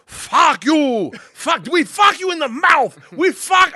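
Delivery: put-on high, loud Russian-accented cursing; Igor. Gangster to cop he grabbed, furious accented shouting. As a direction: bellow broken-English abuse with a Russian accent. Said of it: "just starts going".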